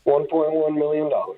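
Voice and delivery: hollow, boomy phone line; inside a barrel. Real, not acted. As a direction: sounds like talking inside a barrel. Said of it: "You sound like you're in a barrel".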